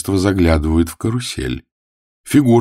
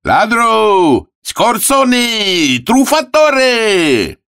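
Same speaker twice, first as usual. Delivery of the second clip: high, loud, drawn-out yells; shouted insults; 5 dB louder, much slower than usual. Acted. Italian sailors denounce the cheat, high loud shouting. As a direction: hurl each word out high and long. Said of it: "кричат".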